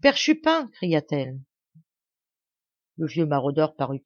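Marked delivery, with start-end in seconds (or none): 0.0-0.7 high, loud call across the distance. A narrator's reading. Blanche hails the old poacher across the woods, loud high call. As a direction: raise the voice to reach him far off. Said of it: "enflant la voix à cause de la distance".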